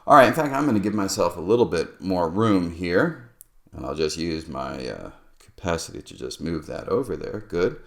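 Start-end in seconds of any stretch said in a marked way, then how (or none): none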